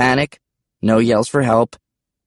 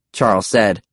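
ordinary delivery, nothing marked

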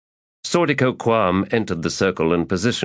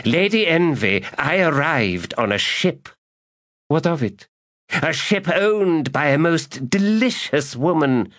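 high, relishing tone; leering insinuation; 10 semitones higher than usual. Acted. Magus insinuating to the lady, high and leering. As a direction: savour the word delicious.

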